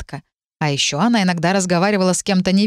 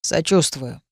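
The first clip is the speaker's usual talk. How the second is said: lower, uninterested reply; low-pitched, unmoved brush-off; indifferent; 4 semitones lower, slower than usual. A narrator's reading. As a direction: offer token sympathy without caring. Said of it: "Равнодушно поморщилась соседка".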